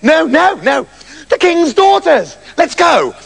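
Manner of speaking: bursting to show them; excited. Acted. Stupid eager.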